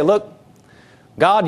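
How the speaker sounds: ordinary talk, nothing marked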